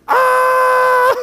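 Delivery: high, loud shriek of laughter; a high, loud yelp of disbelieving laughter at the punchline; laughing shriek. Retold or imitated. His reaction to the punchline.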